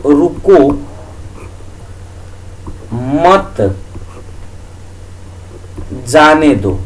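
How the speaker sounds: slow, a long pause after each word. Shown as a demonstration. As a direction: spell it out one piece at a time. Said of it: "एक example जैसे होता है". (शब्द-शब्द अलग, लंबे ठहराव के साथ)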